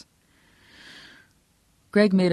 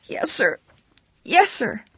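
put-on high, panicky kid voice, building louder; terrified; 10 semitones higher, 4 dB louder, slower than usual. A narrator's reading. Kid placating the man who screamed at him, terrified. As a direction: blurt it high, desperate to obey.

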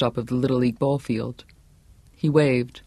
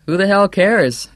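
higher, louder carefree retort; laughing retort; 5 semitones higher, 6 dB louder than usual. A narrator's reading. Young man answering Johnny at the rally, mild laughing bravado. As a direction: toss it back loudly over applause. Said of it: "responded, laughing".

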